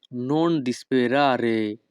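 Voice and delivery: each Italian word spoken slowly and clearly; teacher modelling Italian for learners, very slow; slow demo. Shown as a demonstration. Bengali teacher models Italian phrase for students, slow and careful. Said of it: "Italian phrase demo".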